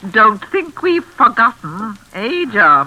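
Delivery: slow, sly, taunting line after a laugh; gloating. Acted. The mother gloating to Susan, slow and sly. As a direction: savour the threat. Hostess gloats over the anniversary.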